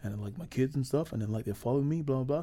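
low, soft mumbling Rodman voice; lightly voiced rambling Dennis Rodman; Dennis Rodman impression. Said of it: "He's like".

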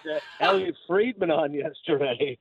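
usual speaking voice

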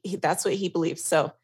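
usual speaking voice

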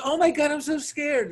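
high-pitched mock fright; mock scared. Man today scared by a prank, mocking high voice. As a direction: imitate a frightened dude, hammed up. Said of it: "like, scared".

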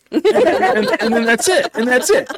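amused that the deal just ends; laughing disbelief. Real, not acted.

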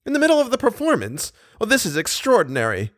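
high, incredulous repeat of the phrase; astonished repeat. A narrator's reading. Manager echoing the commissary, astonished. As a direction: echo it back in shock. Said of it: "he repeated".

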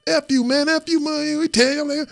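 high, heated cursing match; cursing out a Giants player, high and heated; cursing at opponent. Retold or imitated. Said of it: "arguing with him".

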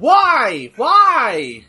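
high, loud, drawn-out repeated cries; anguished wail. Real, not acted. Fan protesting an awful finish, loud wail. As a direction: wail in protest, falling off each time.

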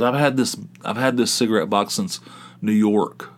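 ordinary delivery

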